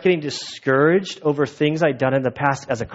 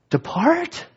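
anguished plea; 13 semitones higher than usual. Retold or imitated. Peter begs Jesus to leave.

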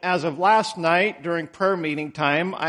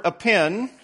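ordinary talk

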